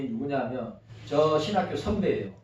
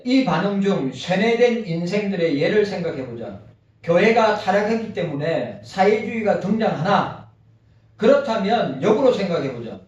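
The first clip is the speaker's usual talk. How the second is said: reading aloud; 4 semitones higher, 5 dB louder than usual. Acted. Continues reading the article.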